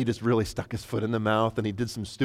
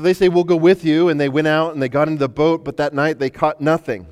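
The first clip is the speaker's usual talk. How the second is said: fervent preaching; 4 semitones higher, 7 dB louder than usual. Acted. Preaching at full force.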